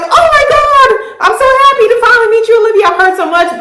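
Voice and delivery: high-pitched, fast, mock-delighted squeal; gushing greeting. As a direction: imitate an overexcited sister meeting the girlfriend. Sister gushes on first meeting.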